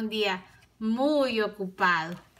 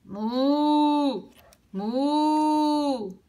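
a lightly voiced moo, a bit higher; gentle storybook moo; cow moo; 5 semitones higher than usual. A narrator's reading. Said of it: "mugió la vaca".

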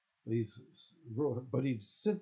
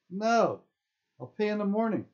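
high, raised-voice protest; indignant refusal; 11 semitones higher, 7 dB louder than usual. A narrator's reading. Indignant camper refusing the guard, raised-voice refusal. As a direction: call back loudly in a pitched-up voice.

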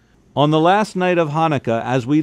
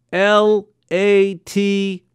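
slow letter by letter; spelling out; 7 semitones higher, much slower than usual. A narrator's reading. Father spelling the word for the colonel, slowly.

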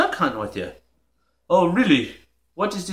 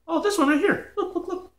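mocking giggles; 9 semitones higher than usual. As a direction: laugh at the other one's gadget. Mocking the other's cord.

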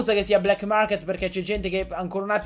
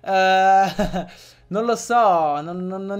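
laughing; 4 dB louder, much slower than usual. Real, not acted. Laughing while naming.

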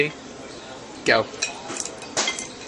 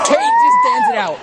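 high excited cheer; excited shout; 5 dB louder than usual. Real, not acted. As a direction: cheer her on loudly. Cheering a friend on.

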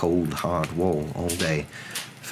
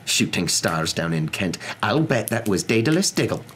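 indignant professor; 6 semitones higher than usual. Acted. Professor keeps complaining in story.

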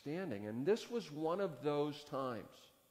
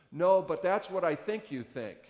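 angry retort; 5 semitones higher, 10 dB louder than usual. Retold or imitated. Angry young man retorts.

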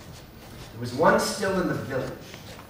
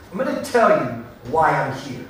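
ordinary delivery

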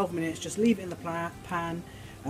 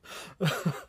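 soft laugh; 6 dB quieter than usual. Real, not acted. Laughs at own impression.

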